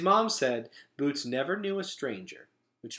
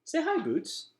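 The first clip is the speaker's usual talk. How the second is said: much higher, sing-song coaxing; coaxing a toddler. A narrator's reading. Gregor urging little sister Boots to greet them. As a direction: coax the baby sister gently.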